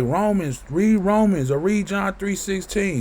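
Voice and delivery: a light parody of pushy Christians; imitating Christians. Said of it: "these Christians ... they like".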